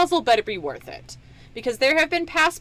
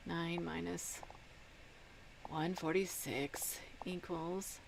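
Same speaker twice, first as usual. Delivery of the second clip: whispered; 4 semitones lower, 15 dB quieter, slower than usual. Real, not acted. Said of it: "working sum under breath".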